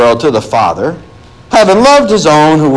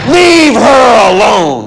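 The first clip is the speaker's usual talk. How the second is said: raised, slow rebuke; forceful command; 7 semitones higher, 3 dB louder, slower than usual. Acted. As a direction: hold them back firmly, slowly. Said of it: "He says, he says".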